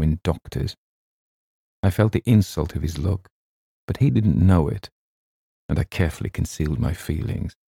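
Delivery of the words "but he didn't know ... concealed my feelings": plain reading voice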